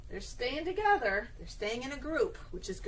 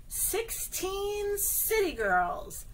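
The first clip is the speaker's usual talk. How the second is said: sing-song reveal; 8 semitones higher, much slower than usual. Acted. Counting book read to children.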